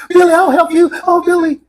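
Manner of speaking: high, sugary mocking fan-girl voice; mocking a fawning salesgirl, pitched high; kiss-ass girl. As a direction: coo at the celebrity like a fawning clerk. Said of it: "this fucking kiss-ass girl".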